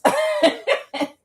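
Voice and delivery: high burst of giggling; real laughter. Real, not acted. Woman laughing at sister's husband, laughing. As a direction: break into a cackle.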